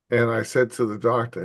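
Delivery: usual speaking voice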